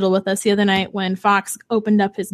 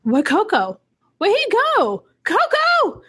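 put-on rising higher and higher, a worried little kid calling; toddler calling out; 11 semitones higher than usual. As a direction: imitate a toddler searching for someone, climbing in pitch. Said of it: "Fox instantly was like".

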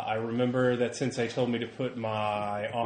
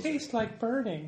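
high, slow, softer joke voice; silly put-on voice; 10 semitones higher, 5 dB quieter, slower than usual. Player joking about stuff up the nose, silly high voice. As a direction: draw it out high and childlike for a joke.

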